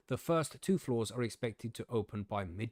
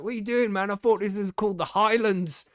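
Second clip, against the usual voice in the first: loud, high-pitched cheeky backchat; re-enacted gobby outburst, pitched up and loud; gobby young protest; 9 semitones higher, 8 dB louder than usual. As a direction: mouth off indignantly at the police. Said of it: "I was young and gobby back then".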